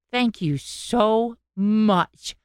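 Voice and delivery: slow, sincere thank-you; genuine heartfelt thanks. Retold or imitated. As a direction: draw out the thanks like you truly mean it. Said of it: "it was just genuine".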